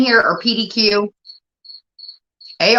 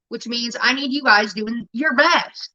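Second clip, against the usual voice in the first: usual speaking voice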